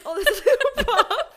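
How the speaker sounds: giggling high through the line; laughing quote. Retold or imitated. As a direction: laugh while quoting him. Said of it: "So he's like".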